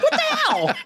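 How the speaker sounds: put-on squeaky high kid voice, laughing; small kid protesting to a bully in a high voice; high child voice. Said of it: "I had a high voice then".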